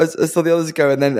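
normal talking voice

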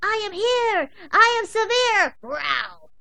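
very high, loud little-dog battle cry. Acted. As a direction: shout it like a tiny fierce warrior. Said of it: "Pebbles would give her battle cry".

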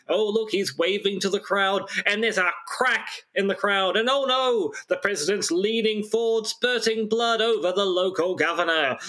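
excitable vintage radio reporter, lightly done; breathless old newsreel commentary; old-timey news voice. Pretend. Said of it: "old-timey news voice".

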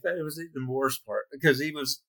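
usual speaking voice

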